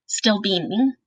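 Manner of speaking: plain reading voice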